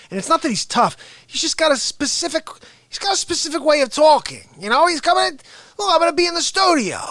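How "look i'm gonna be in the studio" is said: high fast rasp attempt; half-finished Artie rasp; Artie Lange. Comedian demoing his unfinished raspy Artie voice. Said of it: "this rasp to it that I'm working on".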